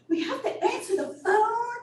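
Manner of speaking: high, whiny mock protest; exaggerated high-pitched retold whine; upset staff. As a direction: imitate staff complaining in dismay, laughing. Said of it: "the staff were just so upset".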